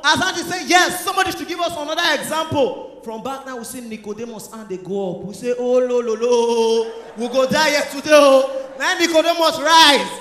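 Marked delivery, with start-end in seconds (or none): 5.6-8.7 mock wail. Pupils panic at hard question.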